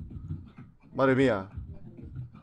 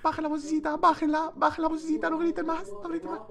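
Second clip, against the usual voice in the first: higher, softer sing-song shush; mock shushing voice; 12 semitones higher, 4 dB quieter than usual. Streamer hushing kids whose parents just came in, high soft mock scolding. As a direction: hush them like a fussy parent.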